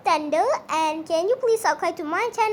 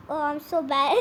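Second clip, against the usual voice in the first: normal talking voice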